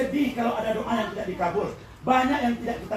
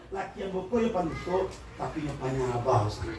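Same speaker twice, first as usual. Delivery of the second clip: reframed gently; 9 semitones lower, 6 dB quieter than usual. Shown as a demonstration. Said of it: "Beda kan?".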